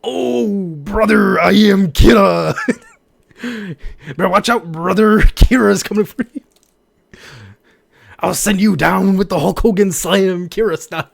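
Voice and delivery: loud, high hammed-up wrestling promo; wrestler promo. Streamer goofing as a wrestler threatening brother, loud. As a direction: bellow it like a pro-wrestling heel.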